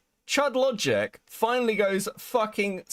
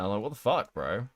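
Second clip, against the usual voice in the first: ordinary talk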